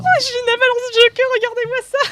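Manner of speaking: cri aigu et très fort de joie; s'émerveiller bruyamment; exclamation émerveillée. Real, not acted. Avalanche de cœurs dans le chat. (émerveillement éclatant)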